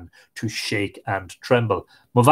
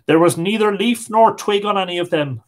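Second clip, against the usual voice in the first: usual speaking voice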